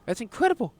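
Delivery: high amazed exclamation. Acted. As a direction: gasp it out in wonder. Voicing amazed game character.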